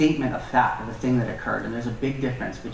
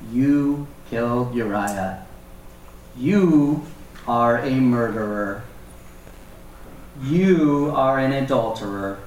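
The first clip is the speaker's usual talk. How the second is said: slow accusation; slower than usual. Acted. Nathan accusing David.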